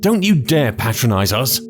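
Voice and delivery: incensed. A narrator's reading. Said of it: "Kevin was incensed".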